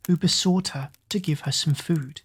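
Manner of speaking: ordinary delivery, nothing marked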